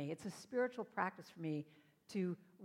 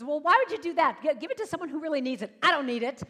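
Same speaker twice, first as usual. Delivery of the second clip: louder, higher protesting stranger; indignant refusal; 5 semitones higher, 13 dB louder, faster than usual. Retold or imitated. Woman refusing the paid coffee, loud and indignant. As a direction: push back hard, a bit fast.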